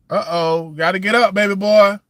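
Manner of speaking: loud, high call across the room. Real, not acted. Calling out to child off-mic.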